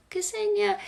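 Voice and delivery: her name said softly, trailing off; sheepish soft reply; quiet and shy. Retold or imitated. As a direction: retell saying her name almost inaudibly. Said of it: "I would say it really quietly".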